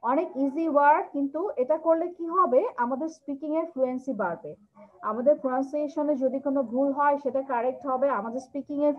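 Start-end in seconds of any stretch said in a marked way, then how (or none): none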